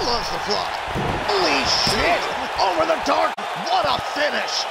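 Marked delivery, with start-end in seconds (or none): 1.3-4.6 excited shout. Real, not acted. The finishing move.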